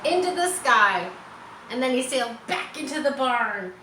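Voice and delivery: usual speaking voice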